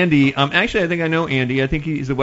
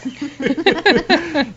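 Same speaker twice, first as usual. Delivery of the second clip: laugh. Real, not acted. Laughing at prom answer.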